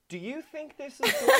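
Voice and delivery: high, loud, incredulous question; mock mid-sex survey. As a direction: squeal it as an absurd check-in, laughing. Mock check-in with partner mid-sex.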